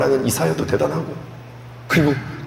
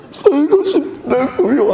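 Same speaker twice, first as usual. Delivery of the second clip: begging plea; 10 semitones higher, much slower than usual. Acted. Hezekiah begging for life.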